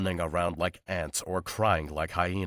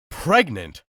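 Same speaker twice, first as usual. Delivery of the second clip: higher, louder, shocked cry; the truth finally lands; shocked realisation; 7 semitones higher, 7 dB louder than usual. Acted. Damon realising Sarah is pregnant, higher and louder in shock. Damon's stunned repeated thought.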